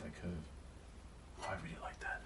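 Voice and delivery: drawn-out whisper along the stroke; slow whisper. Real, not acted. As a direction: hush it, slowly.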